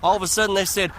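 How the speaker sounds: ordinary talk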